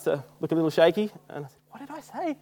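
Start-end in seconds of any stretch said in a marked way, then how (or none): 1.7-2.4 small, high, hushed question; worried asking. Retold or imitated. Confused tourist to his guide, worried asking. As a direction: ask very softly and high, mock alarmed. Alarmed by the girl's reaction.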